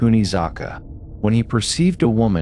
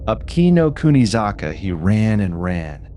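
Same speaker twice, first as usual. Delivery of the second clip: ordinary delivery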